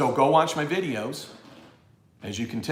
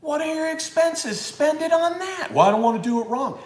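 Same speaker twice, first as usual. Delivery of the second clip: mock high; 5 dB louder than usual. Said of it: "Tom put it in his article".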